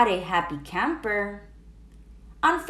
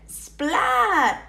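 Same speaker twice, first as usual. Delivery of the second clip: plain reading voice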